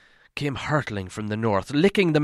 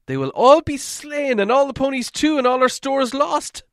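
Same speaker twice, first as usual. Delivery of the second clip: higher, louder lament; moaning; 12 semitones higher, 4 dB louder than usual. Acted. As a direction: wail over certain loss. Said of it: "moaned the others".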